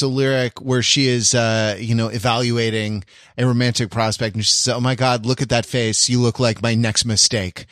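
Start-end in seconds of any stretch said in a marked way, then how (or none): none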